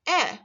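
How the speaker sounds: eh said as in there; teacher models the sound; short e. Shown as a demonstration. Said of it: "pronounce the letters E and I as".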